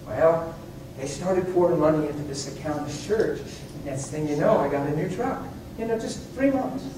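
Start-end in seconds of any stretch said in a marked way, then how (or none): none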